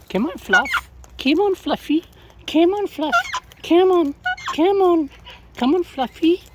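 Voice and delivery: loud high sing-song calls of Fluffy; high calling out. Real, not acted. Calling Fluffy the bird to come over, loud high sing-song.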